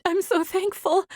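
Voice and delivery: high, teary whimper. A narrator's reading. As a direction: squeeze it out through tears. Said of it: "she whimpered".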